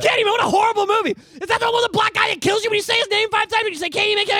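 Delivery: high, loud, fast rant; mock scornful shout. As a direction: ham up a mother scoffing at the film. Mom mocking the movie.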